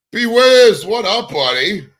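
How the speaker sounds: loud, high-pitched shout-out to a viewer; calling out hello. Real, not acted. Streamer greets a regular viewer who just joined the chat, loud and high. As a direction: call out to him like spotting a buddy across a room.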